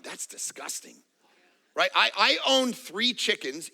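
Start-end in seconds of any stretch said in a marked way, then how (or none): 0.0-1.0 hushed, breathless whisper of disgust. Retold or imitated. Viewer reacting to a nature show, whispered disgust. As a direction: recoil under your breath. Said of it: "have you ever watched nature shows and gone".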